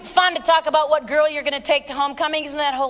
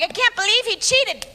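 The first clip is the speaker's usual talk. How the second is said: higher, hammed-up disbelief; mock shocked wife; 7 semitones higher than usual. Wife running to the pastor, mock shocked. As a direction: play the stunned wife, a bit over the top.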